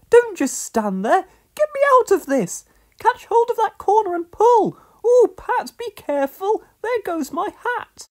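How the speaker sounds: loud, high panicky lady; frantic calls. A narrator's reading. Miss Hubbard tangled in sheets calls to Pat, panicking. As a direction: cry for help while tangled up.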